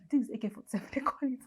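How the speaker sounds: quick laughing aside. Real, not acted. Telling viewers about the robbers' guns, laughing quickly. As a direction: laugh it off while naming it. Laughs over naming the gun.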